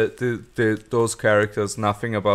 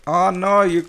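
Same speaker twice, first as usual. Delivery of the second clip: mock panic; 9 semitones higher than usual. Reacting to a wasted character, mock panic. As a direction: squeal it like a fan watching disaster. Retelling own alarmed reaction.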